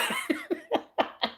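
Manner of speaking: soft, voiceless wheeze of laughter; breathy wheezing laugh. Real, not acted. Host imagining a viewer's voice, wheezing laugh. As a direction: laugh so hard the voice drops out.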